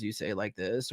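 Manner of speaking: low, level monotone with no inflection; demonstrating a lifeless, low monotone. Shown as a demonstration. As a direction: drone it out dully, quietly. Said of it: "If you're just very monotone".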